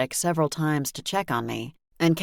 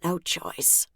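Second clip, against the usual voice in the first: soft, weak croak; 3 semitones higher, 5 dB quieter than usual. Acted. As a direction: croak it out softly. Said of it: "I croaked".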